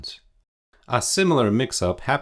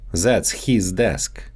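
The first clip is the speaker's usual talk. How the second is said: his said as "heez" with a long ee, Russian style; Russian-accented "his" stretched to heez; his desk with a Russian long ee in "his"; slower than usual. Shown as a demonstration. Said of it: "so they often get mixed up in English".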